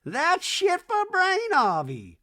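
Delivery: lofty slow mock-mom rant. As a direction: drone it like a grand old dame. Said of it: "if they said it at the same time".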